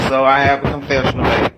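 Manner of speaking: ordinary delivery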